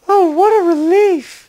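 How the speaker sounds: high-pitched woman's voice; high female voice. Acted. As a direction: play her high and light. Elena voiced.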